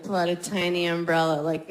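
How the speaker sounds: usual speaking voice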